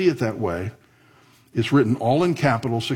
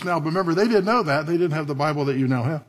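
usual speaking voice

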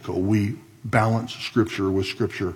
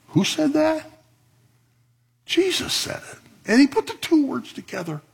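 high impassioned preacher voice; preaching. Acted. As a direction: cry it out high with fervor. Preaching climax on Jesus' words.

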